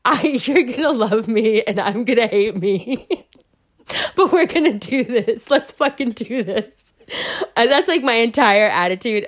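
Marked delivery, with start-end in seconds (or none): 0.0-3.3 apologetic, through laughter; half-say it sheepishly while giggling; sheepish confession breaking into laughs; laughing apology. Retold or imitated. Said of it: "I almost say it, like, apologetically". 4.1-6.9 talking through laughter; rally them while still giggling; amused, game-for-it pep talk; laughing rally. Retold or imitated. Laughs through her own rallying line.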